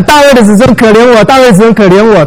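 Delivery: high, loud cry for mercy; blind man shouting. Retold or imitated. Blind man calling to passing Jesus, high loud desperate cry. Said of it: "瞎子在那边喊".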